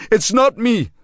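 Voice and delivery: put-on high, loud, harsh local-accented accusation; cornered local man hurling the accusation back; enraged suspect. A narrator's reading. Suspect with a knife accusing Marshall, harsh angry local voice.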